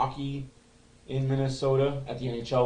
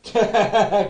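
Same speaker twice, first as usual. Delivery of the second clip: a big burst of real laughter, higher and louder; 7 semitones higher, 8 dB louder than usual. Real, not acted. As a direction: laugh out loud at the joke. Laughs hard after line 60.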